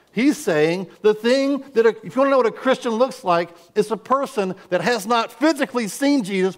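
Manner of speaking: high, fast fervent preaching; preaching at full force. Acted. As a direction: drive it home to the congregation.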